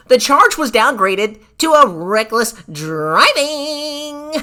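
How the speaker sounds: hammed-up formal letter read; pompous email voice. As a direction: drag it out like a prim lawyer. Reading the email in put-on voice.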